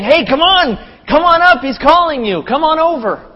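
high, loud, eager urging; excited shout of encouragement; encouraging. Retold or imitated. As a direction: call them over like a cheering crowd. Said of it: "to being encouraging of the blind man".

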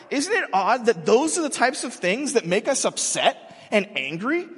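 usual speaking voice

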